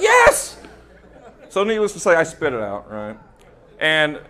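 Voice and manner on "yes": high, loud burst; eager yes. Acted. Intern shouts confirmation to CEO, high and loud. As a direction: shout it triumphantly.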